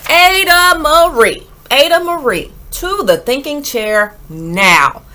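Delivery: loud stern call of her name; scolding parent. A narrator's reading. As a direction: call the child out, fed up.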